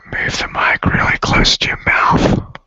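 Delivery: breathy close-mic whisper. Shown as a demonstration. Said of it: "Then you can whisper".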